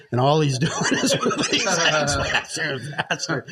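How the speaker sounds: laughing through the words; giggling while telling it. Real, not acted. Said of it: "almost fell off those bar stools".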